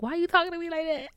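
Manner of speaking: high-pitched, quick, mock-whiny complaint; mock whine. Daughter complains to her mother on the phone, mock whine.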